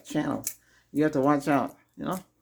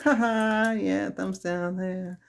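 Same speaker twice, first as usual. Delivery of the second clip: slow, sneering mock laugh; mocking game makers; 6 semitones higher, much slower than usual. Imitating game makers joking about thumbs down, mocking. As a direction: jeer like a smug game maker. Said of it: "they'll tell us a joke".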